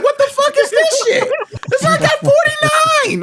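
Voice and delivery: can't believe what you see; shocked disbelief. Retold or imitated. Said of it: "You're like".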